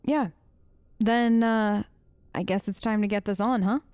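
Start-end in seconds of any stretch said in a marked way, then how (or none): none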